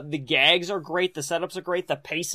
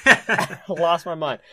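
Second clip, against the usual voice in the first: laughing through the words, slower; 3 dB louder, slower than usual. Real, not acted. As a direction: laugh it out, drawn out. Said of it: "repeated with laugh".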